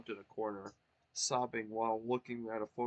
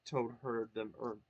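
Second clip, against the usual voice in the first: plain reading voice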